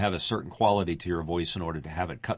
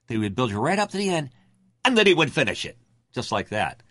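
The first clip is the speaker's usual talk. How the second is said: ordinary talk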